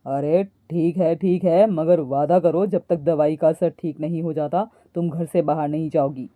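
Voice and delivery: low, firm male character voice; husband. A narrator's reading. Husband makes wife promise. (पति की नीची आवाज़)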